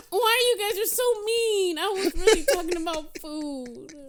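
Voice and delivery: high, whining protest; whiny hurt. Real, not acted. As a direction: plead like you're hurt.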